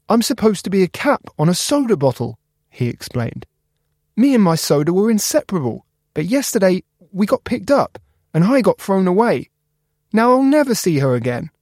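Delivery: high, mournful small voice; sad little cap. Acted.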